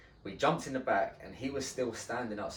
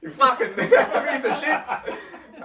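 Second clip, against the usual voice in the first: higher, louder, slowed insult broken by laughter; laughing insult; 12 dB louder, much slower than usual. Real, not acted. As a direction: laugh through a mock insult.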